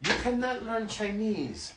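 high-pitched mock Chinese-accented refusal; imitated Chinese accent. Foreign tutor telling student he cannot learn, Chinese accent. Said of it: "as I use the accent".

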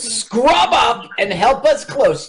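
high, slow put-on command; retold bossy order. Retelling the nurse ordering the doctor around, mock bossy command. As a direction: order it high and stretched out, play-acting. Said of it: "And she goes".

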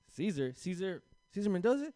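rising disbelief, pitch climbing; mock mom surprise. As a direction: mom putting two and two together, rising. Mom recognizing the name.

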